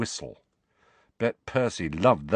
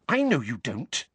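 put-on higher, hasty girl's voice; quickly; 6 semitones higher than usual. A narrator's reading. Hermione quickly soothes Harry. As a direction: Hermione heading him off. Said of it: "said Hermione quickly".

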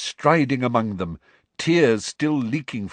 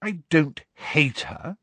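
slow, higher, superior tone; lofty; 6 semitones higher, slower than usual. A narrator's reading. Hermione answers the charge of hating Trelawney, lofty. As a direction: deny it loftily, taking your time. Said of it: "said Hermione loftily".

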